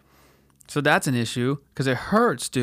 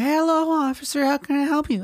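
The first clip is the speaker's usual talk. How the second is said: high, meek falsetto greeting; nervous falsetto politeness; insecure high voice; 13 semitones higher than usual. Retold or imitated. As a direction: greet the cop in a squeaky timid voice. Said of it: "in that bitch voice".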